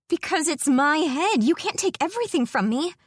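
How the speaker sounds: hurt, indignant protest; upset outburst. Acted. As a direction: fling it back at him. Angry outburst at her captor.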